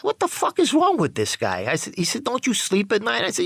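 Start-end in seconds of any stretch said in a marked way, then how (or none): 0.0-1.7 puzzled associate. Retold or imitated. Associate puzzled by his nodding off. 2.2-3.2 puzzled associate. Retold or imitated. Associate asks about his nodding.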